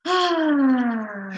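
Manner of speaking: big audible exhale on "ah"; long releasing sigh. Shown as a demonstration. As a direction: let the last breath go with a drawn-out sigh. Final guided breath released.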